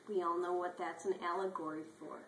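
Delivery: ordinary talk, nothing marked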